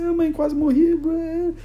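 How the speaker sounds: very high, whining mock wail; crying child. Child released by the tiger tells mother, hammed-up crying. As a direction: imitate a kid bawling to a parent. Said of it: "vai chegar na mãe e falar assim".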